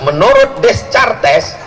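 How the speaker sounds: Descartes read letter by letter as written, des-kar-tes; mock the reader who sounds out Descartes; wrong spelling pronunciation. Shown as a demonstration. Preacher mocks students who misread the philosopher's name, spelling-pronounced. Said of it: "Jangan nanti kalian bilang".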